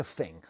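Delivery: "thing" said as "fing"; TH fronting. Shown as a demonstration. Returning to the album title's word, "thing" said as "fing". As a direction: replace the TH in "thing" with F. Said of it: "That's called T H fronting".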